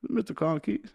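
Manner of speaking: high-pitched impression of an American comedian; Reg. Comedian greeting his friend on the phone, high put-on voice. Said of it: "I'm not gonna do the voice".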